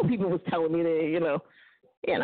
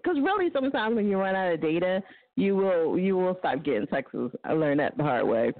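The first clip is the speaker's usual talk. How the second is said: normal talking voice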